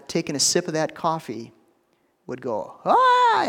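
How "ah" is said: loud, very high scalded shriek; mock pained scream. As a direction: shriek as if burnt by hot coffee. Young lad scalded by coffee, acted.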